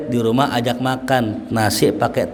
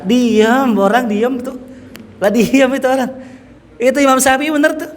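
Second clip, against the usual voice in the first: excited exclamation; 11 semitones higher than usual. Acted. Preacher exclaims loudly.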